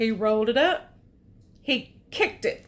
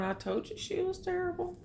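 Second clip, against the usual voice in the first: higher, softer and quick, a lightly voiced grumpy Claus; 7 semitones higher, 8 dB quieter, faster than usual. Reading to a child, Claus grumbles a quick told-you-so. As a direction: toss it off fast and high like a told-you-so. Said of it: "there's Claus going".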